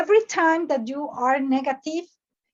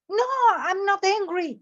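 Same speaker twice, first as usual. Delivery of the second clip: higher-pitched, mock-indignant denial; angry denial; 6 semitones higher than usual. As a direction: deny it like someone offended. Acted angry reply in a role-play.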